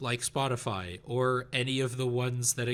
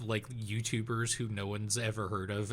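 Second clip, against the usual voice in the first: normal talking voice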